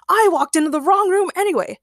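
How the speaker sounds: high, proud declaration; loud proclamation. A narrator's reading. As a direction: announce it grandly in character. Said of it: "he proclaimed quite loudly".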